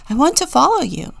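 a quick, eager plea; eager follower. A narrator's reading. Man speaking to Jesus.

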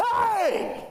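high, sharp call to stop someone; dad's sharp hey. Retold or imitated. Dad confronts the bodyguard in the crowd, sharp high hey. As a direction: bark it at the bodyguard.

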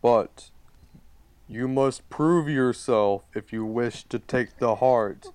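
slow, solemn ancient tree voice; talking tree. Acted. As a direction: lay down a challenge, slowly. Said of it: "The giant tree goes".

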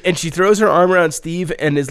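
ordinary talk

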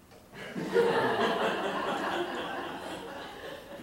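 high, long laughing burst; big laugh. Real, not acted. Pastor to congregation, high laughing burst. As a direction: laugh out loud at the meme.

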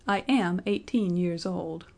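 slow, steady, every word weighted; firm voice. A narrator's reading. Benjamin facing the furious registrar, slow and firm. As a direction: stand your ground with dignity. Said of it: "repeated in a firm voice".

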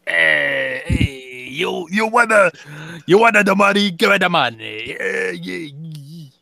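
raspy alien shopkeeper haggling in a thick put-on accent; Watto voice. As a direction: play the greedy junk dealer pushing a sale. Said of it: "it needs to be in Watto's voice".

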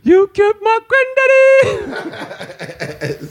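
high, slow sung wail with laughter; mock wail. T'Challa wailing over his granddaddy, high sung mock wail. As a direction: wail it like a hammed-up mourner.

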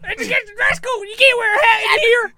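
very high, hysterical squeal; hammed-up high-pitched outrage; mock panic. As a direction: wail it in exaggerated alarm. Mock panic over the hat.

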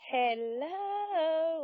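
high, drawn-out, eerie hello; imitated old southern ghost. Imitating the elderly man's ghostly reply, eerie and drawn out. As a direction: stretch it long and high, like an old Southern spirit. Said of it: "like that".

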